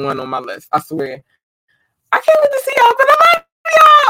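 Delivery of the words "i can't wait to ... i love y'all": much higher, fast squealed line; excited squeal. Real, not acted. Streamer telling fans she loves them, squealing.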